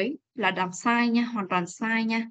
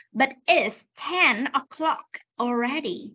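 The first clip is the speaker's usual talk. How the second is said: slow reading; 3 semitones higher, much slower than usual. Shown as a demonstration. Teacher models for a student, slow reading. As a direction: draw out each word of "But it's ten o'clock already". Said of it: "đọc lại cho cô cái đọc chậm này".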